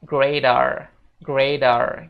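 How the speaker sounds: "Grader" with a heavy American R at the end. Shown as a demonstration. Teacher demonstrates the American r in "Grader". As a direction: thicken the R in "Grader". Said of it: "R-nya ditebelin ya".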